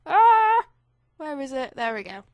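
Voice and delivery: usual speaking voice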